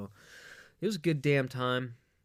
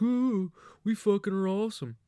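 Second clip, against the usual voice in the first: high, loud, cocky sneering fan voice; mocking rival fans; 9 semitones higher, 5 dB louder than usual. Mocking Kentucky fans bragging, high and cocky. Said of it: "They're like".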